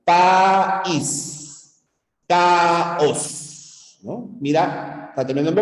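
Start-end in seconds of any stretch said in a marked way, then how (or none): 0.0-1.8 "País" split as a long "pa", then "ís"; syllable boundary shown. Shown as a demonstration. Teacher showing a vowel meeting a vowel, split syllables. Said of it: "termina en vocal". 2.3-4.0 "caos" split as a held "ca", then "os"; syllable boundary shown. Shown as a demonstration. Teacher giving another vowel boundary example, split syllables. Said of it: "empieza en vocal".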